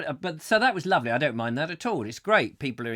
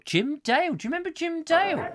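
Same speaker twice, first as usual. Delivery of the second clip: surprised; 13 semitones higher than usual. Real, not acted. Exclaiming in surprise.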